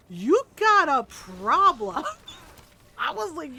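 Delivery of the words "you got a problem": loud, drawn-out mock outrage; exaggerated call-out. Player mock-scolding Mystra, loud exaggerated call-out. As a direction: belt it out slowly, milking every word. Acted reaction to game's revelation.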